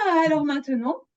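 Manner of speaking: normal talking voice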